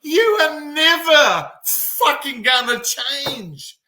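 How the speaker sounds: slow, high, punchy and intense; fired-up preaching. Acted. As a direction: hammer it home like a coach.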